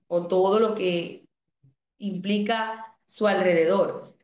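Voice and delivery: ordinary talk, nothing marked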